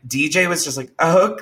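ordinary talk, nothing marked

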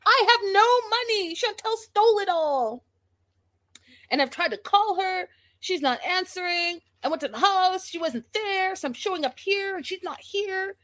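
high, put-on whiny man's voice; mock him in a high, petty voice; mocking Pedro. Imitating Pedro complaining to Thomas, high mocking voice. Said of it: "tells Thomas Chantel stole".

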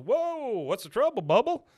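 high, loud hammed-up cowboy cheer; mock cheery greeting. Host voicing the country star greeting Bobby, high hammed-up cheer. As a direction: whoop it up like a jolly country star.

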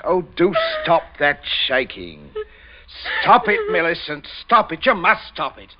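loud, high, forceful orders; harsh commands. Acted. Father barking at his trembling daughter, angry. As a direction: bark at her to stop shaking.

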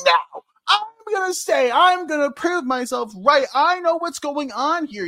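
high, insistent hero retort; hammed-up defiant young hero; mock defiance. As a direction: dig in stubbornly, playing it up. Acts Percy defying Zeus.

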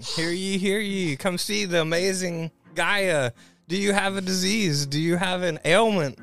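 loud, high hawker's pitch; town crier. Pretend. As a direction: cry out to the whole street. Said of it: "We're just announcing".

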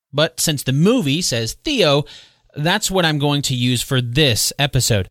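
ordinary talk

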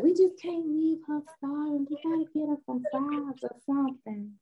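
a hint of a pleading kind friend; Debbie voiced. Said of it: "'Cause Debbie be like".

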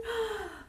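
sharp intake of shocked breath; horrified gasp. A narrator's reading. Horton hearing the threat to the speck, horrified gasp. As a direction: gasp in alarm. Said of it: "gasped Horton".

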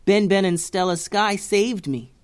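Bussy telling her aunt about her rescuers. Acted. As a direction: proudly tell her aunt. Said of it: "said Bussy".

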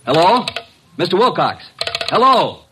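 loud, high calls into a silent phone; calling out. Acted. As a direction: shout down the line, worried.

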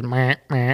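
short, repeated buck grunts; "meh" as an animal call; imitated deer grunt. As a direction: mimic a buck grunting at every step. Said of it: "he must have grunted".

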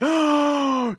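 loud, high theatrical gasp; horrified gasp. Acted. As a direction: gasp loudly in mock horror. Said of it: "they're all like".

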